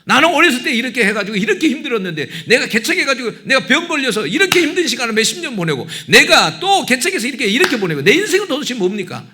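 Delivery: high, loud retold complaint in prayer; protesting to God. Pastor re-enacts questioning God at the altar, loud impassioned protest. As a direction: plead and argue upward, re-enacting a tearful protest. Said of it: "막 하나님 막 따졌어".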